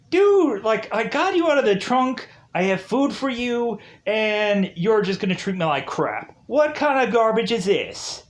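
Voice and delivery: bursting out dude, exasperated at an ungrateful passenger; indignant rant. Retold or imitated. 47 yelling at ungrateful Nika.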